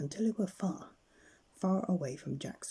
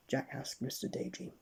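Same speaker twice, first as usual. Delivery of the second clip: ordinary delivery, nothing marked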